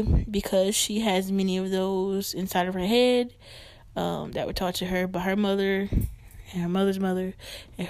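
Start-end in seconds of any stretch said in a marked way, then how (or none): none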